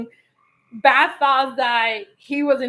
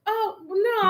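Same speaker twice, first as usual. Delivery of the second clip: high-pitched mock alarm; dismayed; 7 semitones higher than usual. Wife sees husband rip book apart, mock dismay. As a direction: re-enact horror at the damage, playfully.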